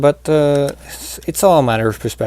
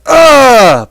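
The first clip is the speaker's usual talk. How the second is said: loud, high acted cry; hammed-up scream of pain; mock pained yell; 13 semitones higher, 11 dB louder than usual. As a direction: yell out as if just stabbed. Cries out at being stabbed.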